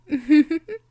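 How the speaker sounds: a loud, high, gleeful villain laugh; triumphant laugh. Acted. Vampire mistress as her captive obeys, gleeful loud laugh. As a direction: laugh as your prey falls under.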